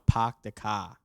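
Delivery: put-on Boston accent, "car" said "cah" with no r; light Boston accent on "Park the car". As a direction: drop the r's the Boston way. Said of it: "somebody from Boston will say".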